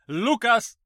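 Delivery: sharp high call of a name; loud shout. A narrator's reading. Doctor calling a raving patient to attention, loud shout. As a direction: shout to get his attention. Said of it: "hangosan rákiáltott".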